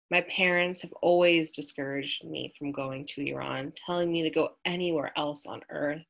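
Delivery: ordinary delivery, nothing marked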